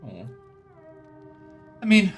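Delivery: ordinary talk, nothing marked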